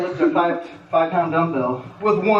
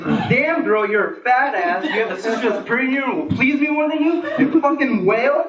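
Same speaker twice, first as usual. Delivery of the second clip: mock twisted version; 5 semitones higher than usual. Woman mishearing his compliment, mocking. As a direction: ham up the rude version. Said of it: "what she heard was".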